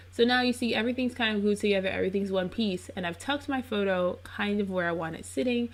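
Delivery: usual speaking voice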